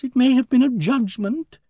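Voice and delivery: very high, tentative voice rising at the end; timid Emily Barton. A narrator's reading. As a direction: venture "judgment" timidly, high and lilting upward. Timid lady voiced in story.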